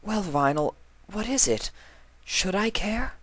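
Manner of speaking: flustered high mare voice; stammering Octavia. Acted.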